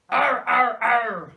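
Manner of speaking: loud pirate ar; teacher demonstrating the pirate ar; deep pirate voice. Shown as a demonstration. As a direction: growl it out fierce. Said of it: "really deep voice when you do it".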